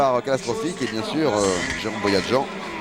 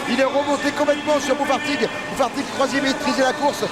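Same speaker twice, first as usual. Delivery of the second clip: high, excited overtake call; motorsport commentator; 11 semitones higher than usual. Real, not acted. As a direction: shout the decisive pass with awe. Live call of decisive overtake. (commentateur surexcité)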